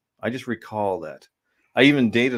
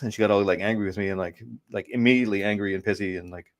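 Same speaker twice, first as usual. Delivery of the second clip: ordinary talk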